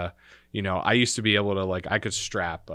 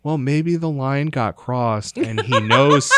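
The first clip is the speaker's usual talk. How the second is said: imitating grandma, slow, louder, reasoning stubbornly; drawn-out, raised-voice explanation from a retold grandma; insistent grandma; 4 semitones higher, 8 dB louder than usual. Grandmother insists in retold family argument.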